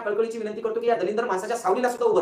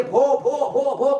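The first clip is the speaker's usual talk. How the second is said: mock barks, punched out one by one; imitated dog barking; 2 semitones higher, 4 dB louder, much slower than usual. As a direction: bark out each word like a dog. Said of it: "कुत्र्यावानी जे भुकायले".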